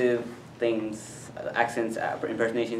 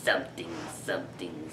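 low, soft villain impression; Emperor Palpatine; 7 semitones lower, 11 dB quieter than usual. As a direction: murmur it deep like an evil emperor. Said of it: "Emperor Palpatine".

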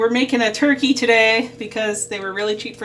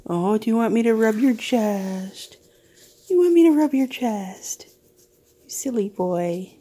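pet talk. Real, not acted. Talking to the pet.

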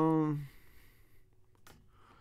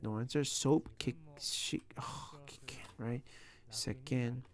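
ordinary delivery

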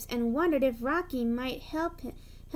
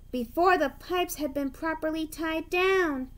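plain reading voice

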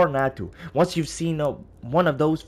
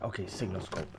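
whisper; 5 semitones lower, 11 dB quieter than usual. Real, not acted. Quiet aside to self.

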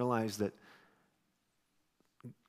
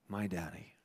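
much softer, lower, almost breaking; tender hush; 7 semitones lower, 12 dB quieter than usual. Retold or imitated. Preacher recalling the fatherless boy, softly and tenderly. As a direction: say it gently, holding back tears.

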